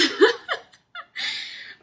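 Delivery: a burst of high giddy laughter; squealing happy laugh; high delighted laugh. Real, not acted. Overwhelmed by message.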